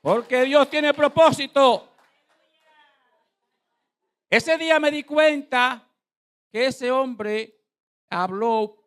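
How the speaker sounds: slow, high, loud declaration; full-force preaching. Real, not acted. Preacher drives home God's purpose to the congregation, full-force preaching. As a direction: proclaim it to the whole church, spacing out each phrase.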